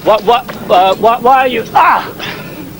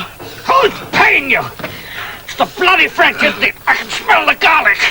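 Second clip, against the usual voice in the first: raised, scornful questioning; interrogating officer; 13 semitones higher than usual. Acted. Officer accusing the mercenary of working for the French, scornful. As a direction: sneer the accusation at him.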